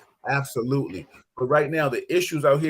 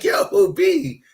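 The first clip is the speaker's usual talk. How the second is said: imitating dad; 11 semitones higher, 5 dB louder than usual. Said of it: "He was like".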